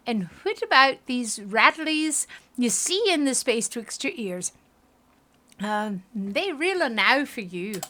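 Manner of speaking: slow, hammed-up Scottish brogue; bad Scottish accent. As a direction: put on a shaky amateur Scottish accent. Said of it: "My Scottish accent is really horrifying".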